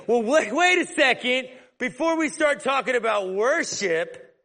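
hammed-up objection; a hint of a pushy Jesus; mock pushy retort. Mock Jesus he doesn't play.

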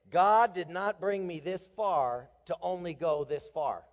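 each word punched, proclaimed; preached mantra. Shown as a demonstration. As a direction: declare it like a creed. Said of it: "Here's a saying you need to repeat often".

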